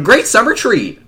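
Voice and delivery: high, bright commercial pitch; cheery ad voice. Acted. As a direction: sell it like an ad. Mock advert line.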